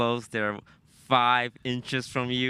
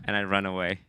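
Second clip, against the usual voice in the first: usual speaking voice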